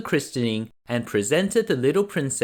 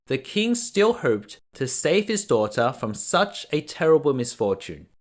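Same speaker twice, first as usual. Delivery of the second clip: ordinary delivery, nothing marked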